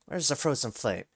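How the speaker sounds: ordinary talk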